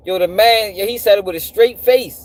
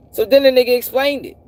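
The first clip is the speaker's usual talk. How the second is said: usual speaking voice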